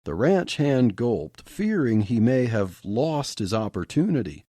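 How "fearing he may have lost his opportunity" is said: usual speaking voice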